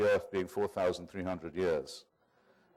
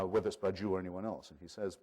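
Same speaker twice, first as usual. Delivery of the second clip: normal talking voice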